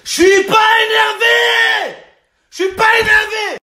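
high, stretched-out angry yell; furious denial. Acted. Gambler denying to the tobacconist he's worked up, furious yell. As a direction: scream that you are calm. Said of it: "ça sert à rien de t'énerver".

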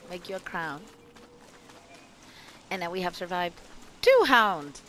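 ordinary delivery

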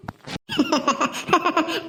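short teasing laugh; mocking laugh. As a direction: laugh at the son's expense. Servant mocks the son. (ಗೇಲಿ ಮಾಡುವ ನಗು)

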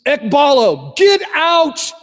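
loud, high cast-out order; exorcist's shouted expulsion; commanding shout. Retold or imitated. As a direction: drive it out with full force. Casting out demons.

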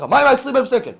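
high, loud money demand; demanding lawyer. Acted. (דרישה תקיפה בקול רם וגבוה)

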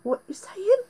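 ordinary delivery

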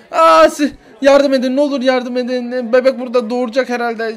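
high, loud desperate plea; frantic begging shout; screaming for help. Acted.

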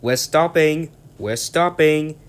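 high, loud call, slowing on the repeat. Acted. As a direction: shout a warning to the trucks. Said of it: "Thomas called out".

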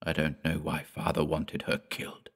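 softly, almost under his breath; a hushed, secretive whisper; a boy whispering so the adults won't hear. A narrator's reading. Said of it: "Peter whispered".

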